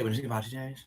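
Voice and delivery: usual speaking voice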